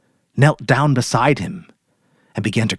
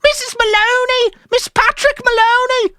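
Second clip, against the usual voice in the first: much higher, panicked shriek; frantic cry; 4 dB louder than usual. Acted. Mary crying to the police on the phone, frantic. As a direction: scream for help down the phone.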